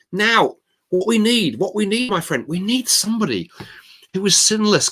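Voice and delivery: high-pitched, exultant mock celebration; triumphant. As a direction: act out a zealous friend seizing the breakthrough. Friend triumphs once confession is won.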